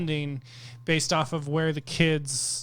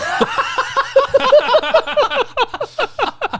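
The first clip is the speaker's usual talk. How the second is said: big burst of laughter; 8 dB louder than usual. Real, not acted. Laughs hard.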